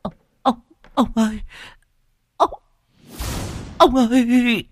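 very high, piercing scream of his name; wife howling at the shape in the doorway; shrieking in terror. A narrator's reading. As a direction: scream for your husband in pure horror. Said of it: "bà Hoa rú lên kinh hãi".

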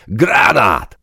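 high, loud, drawn-out alarm call; warning shout. A narrator's reading. Soldier warning comrades of a grenade, shouted. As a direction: shout a warning for everyone to duck.

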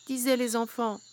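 ordinary delivery

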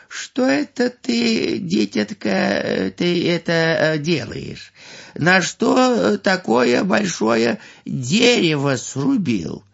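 put-on high, slow curious old man; wondering old man. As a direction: ask slowly in wonder. Old man asks slowly.

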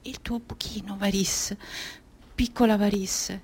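very soft, almost whispered; hushed tenderness. Acted. Varis speaking to herself about her wound, hushed. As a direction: speak gently to your wounded child self.

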